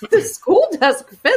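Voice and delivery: high, drawn-out baffled question, amused; incredulous echo. Real, not acted. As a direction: repeat it slowly in amused disbelief. Incredulous laughing echo of odd phrase.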